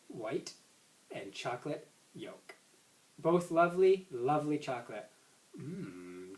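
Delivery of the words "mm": low, soft contented mm; mumbled mm. Acted. Boy savoring chocolate egg, mumbled mm. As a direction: mumble it with a full mouth. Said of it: "John mumbled".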